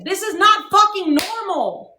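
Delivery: loud, high, furious retort; angry outburst. Real, not acted. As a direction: spit it back at them hard. Angry rant about drama.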